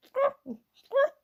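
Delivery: high, squeaky little growl attempt; two tiny growls that don't quite work; baby bear growl. Baby Bear tries growling, high and feeble. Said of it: "didn't have a proper growl yet".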